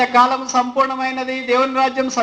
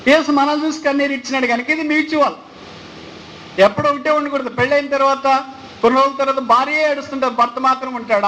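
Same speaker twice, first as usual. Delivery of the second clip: ordinary talk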